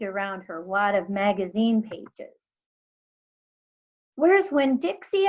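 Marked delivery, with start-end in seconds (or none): 4.2-5.3 high, loud alarmed shout. A narrator's reading. Girl shouting for her missing dog. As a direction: shout in sudden panic. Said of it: "I shouted".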